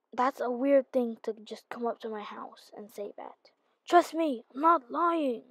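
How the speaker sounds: ordinary talk, nothing marked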